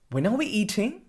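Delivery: "eating" rising up at the end of the question; rising question tune; upwards inflection. Shown as a demonstration. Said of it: "we usually have an upwards inflection".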